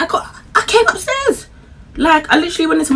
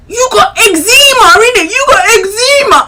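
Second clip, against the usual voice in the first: mimicking boyfriend; 12 semitones higher, 6 dB louder than usual. Boyfriend on the phone naming her eczema, mock excited. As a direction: tease her with the word. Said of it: "Say it then".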